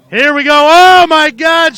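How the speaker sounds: loud, high shout of disbelief; excited shout. Real, not acted. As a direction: yell it as the crash happens. Wild crash in race commentary.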